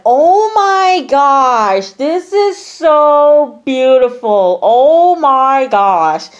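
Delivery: high, loud, long-drawn gush; gushing mock amazement. Friend reacting to the painting reveal, gushing in mock amazement. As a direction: stretch every word in exaggerated awe.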